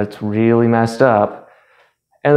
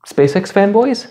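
eager guess; 8 semitones higher than usual. Acted. Playing the audience's guess.

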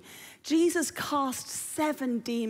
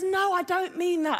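Rose; 4 semitones higher than usual. Acted. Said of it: "And Rose says".

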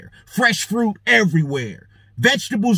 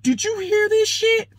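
very high-pitched disbelief; shocked aside; 13 semitones higher than usual. Retold or imitated. Shopper to companion about the overheard row, scandalised. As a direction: squeal it to a companion in mock shock.

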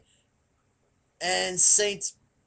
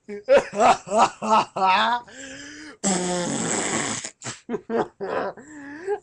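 hysterical laughing sob; a ragged cry that turns into a laugh; hammed-up hysterical laugh-sob; laugh-cry wail.

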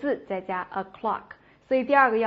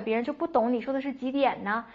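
normal talking voice

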